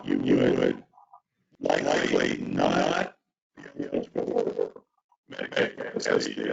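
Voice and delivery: deep, distorted glitchy audio voice; monster voice. Real, not acted. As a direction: sound like a warped low monster over a bad connection. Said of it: "a terrible monster voice".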